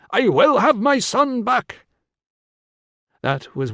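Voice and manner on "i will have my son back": high, loud, drawn-out cry; commanding cry. A narrator's reading. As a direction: cry out slowly over everyone, pitched high. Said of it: "Senesio's cry cut through".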